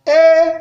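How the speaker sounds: Et held on a high, level tone. Shown as a demonstration. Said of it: "Ça donne ceci". (« Et » au ton haut)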